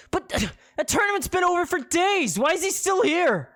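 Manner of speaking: high, loud disbelief; can't believe he's still here; shocked outburst. Acted. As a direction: burst out in outrage. Penelope shocked at lingering man.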